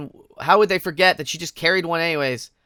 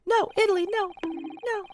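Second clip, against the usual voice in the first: high, wailing mock cry of no; Italy no meme cry; 6 dB quieter than usual. Streamer reacting to the game with a wailing meme cry. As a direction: whine it like a crying cartoon.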